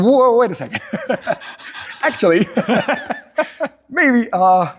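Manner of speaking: eager flustered turnaround; smitten excitement. Retold or imitated. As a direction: suddenly perk up and laugh at yourself. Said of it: "And I was like".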